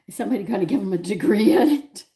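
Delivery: laughing out the punchline in disbelief; laughing quote. Retold or imitated. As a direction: retell the joke while cracking up. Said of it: "I fell out laughing".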